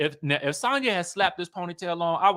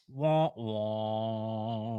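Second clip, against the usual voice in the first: sad trombone wah wah; 6 semitones lower, 7 dB quieter than usual. Retold or imitated. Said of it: "now I'm like".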